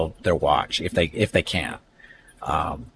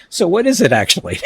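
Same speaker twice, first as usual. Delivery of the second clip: higher, louder imitation of a baffled mother; retold puzzled mom; 9 semitones higher, 5 dB louder than usual. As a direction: play mom's puzzled question, pitched well up.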